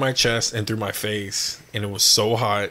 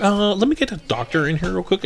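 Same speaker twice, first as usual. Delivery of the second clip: retold worry, pitched up high; mildly freaked-out nurse; 8 semitones higher than usual. Patient imitating staff calling for a doctor, mildly rattled. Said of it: "when they're, like, freaking out".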